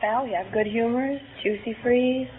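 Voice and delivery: normal talking voice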